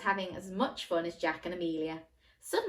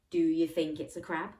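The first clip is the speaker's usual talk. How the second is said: ordinary delivery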